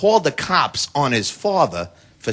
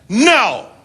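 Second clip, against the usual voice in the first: put-on kid's yell; 5 semitones higher than usual. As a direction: yell it like a furious kid. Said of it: "top of his lungs".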